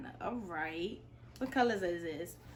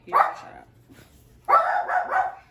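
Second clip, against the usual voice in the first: exasperated; 12 dB louder, much slower than usual. Real, not acted. Annoyed at the noisy child.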